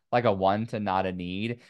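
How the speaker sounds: ordinary talk, nothing marked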